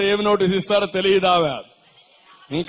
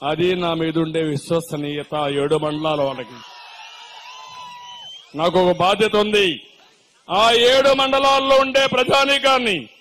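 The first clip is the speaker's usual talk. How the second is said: ordinary delivery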